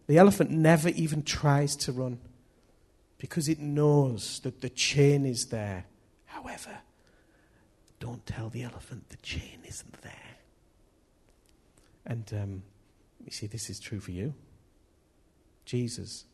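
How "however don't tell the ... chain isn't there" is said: hushed stage whisper; conspiratorial whisper. Pretend. Preacher letting listeners in on the elephant's secret, whispering.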